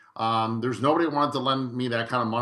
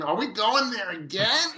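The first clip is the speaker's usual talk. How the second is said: higher-pitched mock whine of a child; complaining kids; 9 semitones higher than usual. Kids grumbling about visiting the renovation house, mock complaining. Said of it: "the kids would complain".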